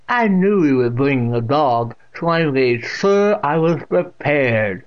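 put-on loud, slow old woman; deaf old aunt declaring. A narrator's reading. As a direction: declare it with heavy, stretched words.

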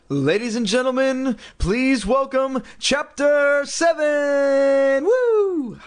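high, loud, stretched-out host voice; game-show announcer. Acted. Reader hyping the next chapter like a game-show host. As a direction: announce it like a hammed-up showman. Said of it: "reader announces the chapter".

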